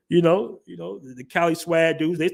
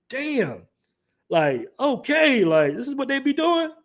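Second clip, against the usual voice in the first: high, drawn-out amazed reaction; enamored; 10 semitones higher, slower than usual. Retold or imitated. A kid hearing his uncle's [name] album, amazed. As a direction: marvel slowly at what you're hearing, pitched up. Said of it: "I was just enamored".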